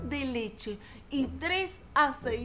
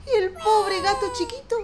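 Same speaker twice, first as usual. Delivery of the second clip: higher, tearful pity for the little cat; 7 semitones higher, 4 dB louder than usual. A narrator's reading. Narrator pities the tiny cat tumbling, tearful tone. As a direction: feel sorry for him as he rolls away.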